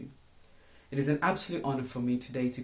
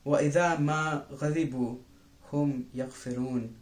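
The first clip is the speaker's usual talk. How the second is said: Quran recitation; much faster than usual. Acted.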